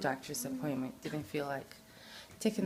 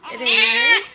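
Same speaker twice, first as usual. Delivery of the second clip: loud high laughing speech; 20 dB louder than usual. Real, not acted. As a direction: laugh while saying it. Laughing through words.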